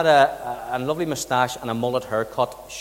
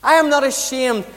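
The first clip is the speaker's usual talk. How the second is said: higher, louder, slow and earnest declaration; voicing Jesus; 7 semitones higher, 6 dB louder than usual. Jesus telling the congregation he is not ashamed, raised earnest voice. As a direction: proclaim it as Jesus would. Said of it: "Jesus turns around and says".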